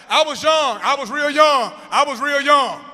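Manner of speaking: high, forceful repeated insistence; loud defensive explaining. Acted. Teacher explaining herself beside grown former pupils, loud defensive protest. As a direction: shout it over the noise. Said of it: "'cause they'll be real loud".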